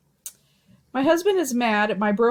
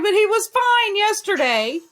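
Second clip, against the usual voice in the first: much higher and louder retold wife's rant; mock exasperated outburst; 13 semitones higher, 6 dB louder than usual. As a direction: imitate a wife crying out in exasperation.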